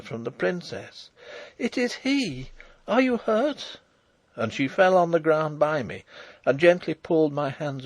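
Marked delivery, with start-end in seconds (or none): 1.6-3.8 high, stifled cry; joyful, fearful cry. A narrator's reading. Princess finding her beloved, half joy, half fear. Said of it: "a low cry, half of joy, half of fear".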